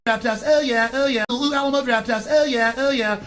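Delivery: prissy trendy hipster cooing over craft beer; sneering parody of a smug hipster; mock hipster.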